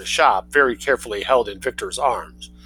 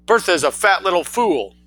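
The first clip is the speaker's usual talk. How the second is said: put-on a sure, raised boy's voice; loud, assured boy; 7 semitones higher, 3 dB louder than usual. Boy insulting his cousin to his mother, loud and assured. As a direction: state it loudly, certain of himself. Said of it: "in a voice that was as loud as his mother's and had more assurance".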